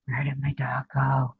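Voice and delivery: ordinary talk, nothing marked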